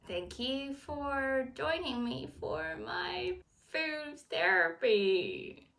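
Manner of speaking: ordinary talk, nothing marked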